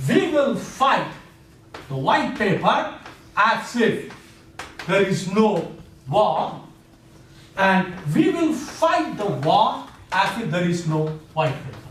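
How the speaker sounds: slow and deliberate, every phrase given weight; slow, weighty quote. Retold or imitated. Lecturer quoting the Zionist leaders' statement to his class, slow and weighty. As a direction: hand down the famous statement slowly, with gravity. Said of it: "They came with a brilliant statement".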